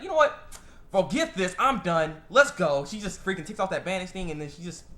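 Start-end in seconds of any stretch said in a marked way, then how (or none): 0.0-2.8 loud, emphatic declaration; acted fed-up outburst. Momiji giving up and going all crazy, mock fed-up shout. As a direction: throw it down like you've had enough. Acts character losing patience.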